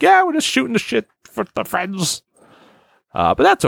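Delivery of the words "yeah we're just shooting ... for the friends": high put-on voice mocking rambling hosts; mocking podcaster voice. Mocking the old top five podcast in a high put-on voice. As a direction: squeak it out like a dopey host. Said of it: "It's just being like".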